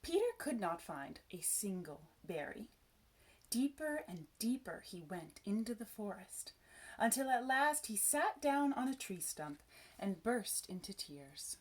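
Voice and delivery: plain reading voice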